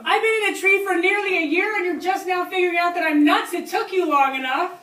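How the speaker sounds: high, loud retorting holler; yelling back down. Retold or imitated. Tree sitter answering the hunters' insult, yelling back down. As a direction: shout the comeback down to them. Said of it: "I yelled back down at them".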